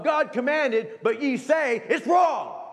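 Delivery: rising to a shout on the last words; preaching shout. Real, not acted. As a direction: hammer it home at full voice. Preacher driving home point.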